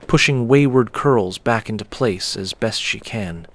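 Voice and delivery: plain reading voice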